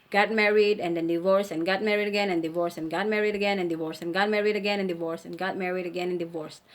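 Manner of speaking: fast rattled list; rapid-fire listing. Shown as a demonstration. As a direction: rush the repeats together. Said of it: "Let's imagine that".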